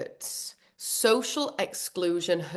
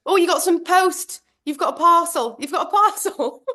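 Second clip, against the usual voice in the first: high, louder mum with trivial news; breezy mum imitation; 8 semitones higher, 4 dB louder than usual. Mum's trivial news after daughter's panic.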